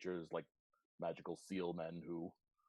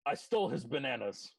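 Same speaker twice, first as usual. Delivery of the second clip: higher, louder admission; raised voice, reluctant confession made clear; 6 semitones higher, 7 dB louder than usual. Acted. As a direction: own up to it out loud. Repeats the confession out loud.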